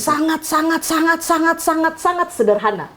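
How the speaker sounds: high, repeated sangat building to the punchline; hammed-up comic repetition; playful emphasis. Real, not acted. Joking build-up of seven S.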